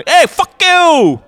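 slow, high furious yell; angry shout. Acted. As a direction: shout at the other driver. Angry driver in imagined scene.

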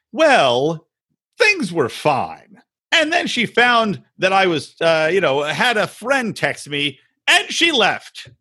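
slow, halting evasive explanation; mock-innocent, drawn-out excuse; imitating Biniam. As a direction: drag out each phrase, pausing before the awkward parts. Imitating Biniam's evasive weird answer.

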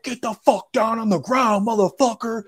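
high, rough shouted order from the agents; agents yelling. Retold or imitated. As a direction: bark it like a cop pinning someone down. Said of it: "They're just like".

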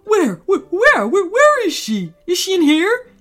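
high, loud, stammering excitement; flustered pillow. Acted. Pillow searching for its owner, flustered and loud. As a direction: stammer it out loudly, frantic to find her. Pillow frantically looks for girl.

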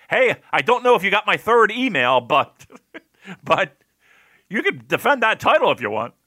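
high, loud mock email voice; pestering email writer. Promotion emailing about the title, mock insistence. Voicing an imagined nagging follow-up email.

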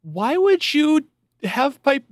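hammed-up wife scolding her husband; mocking the wife. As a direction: squeal it like a hurt bride. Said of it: "then she was like".

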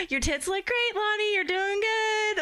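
playful high retold cheer; mock cheering on. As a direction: call it out high, imitating an over-supportive friend. Retold cheering on of her date.

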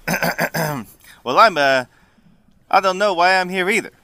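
Italian slipping into Brooklyn; defensive Brooklyn tough guy; Brooklyn accent. Acted. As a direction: swordsman with an accidental Brooklyn twang. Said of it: "he just got a Brooklyn accent".

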